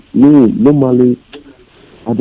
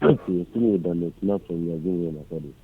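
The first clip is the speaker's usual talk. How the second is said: faint, distant caller on the phone; barely audible, far from the mic; 3 semitones lower, 13 dB quieter than usual. Real, not acted. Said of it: "Your voice is too faint".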